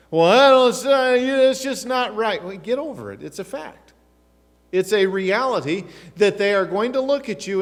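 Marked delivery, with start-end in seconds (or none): 0.1-2.4 loud, high imitation of an objector; mock protest. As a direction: splutter a hammed-up complaint, high and loud.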